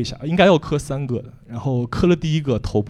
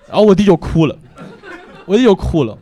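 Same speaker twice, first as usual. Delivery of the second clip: louder, higher, laughing as he repeats it; laughing punchline; 7 semitones higher, 7 dB louder than usual. Real, not acted. 向观众重复笑点，笑着大声说. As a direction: land the joke while cracking up. Punchline about brother crying.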